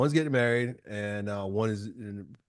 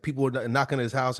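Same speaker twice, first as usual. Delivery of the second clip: usual speaking voice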